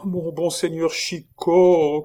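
high, slow, imploring plea; pleading. A narrator's reading. The monk begs Chicot for mercy, pleading. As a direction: beg for mercy with clasped hands. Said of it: "en faisant un effort pour joindre les".

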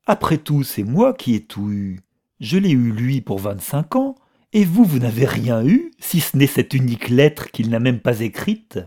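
loud, heated boasting with high peaks; defiant gloating, voice pushed up and out; triumphant taunt. A narrator's reading. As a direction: hurl the victory at a rival. Triumphant taunt to her rival.

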